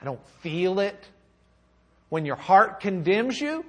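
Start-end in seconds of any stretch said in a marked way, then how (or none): 0.0-1.0 low, hushed confession of doubt; soft, low imagined prayer of uncertainty; voicing a doubter. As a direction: quietly voice someone unsure, starting very soft. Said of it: "where you just say".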